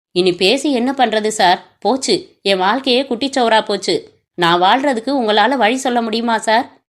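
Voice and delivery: plain reading voice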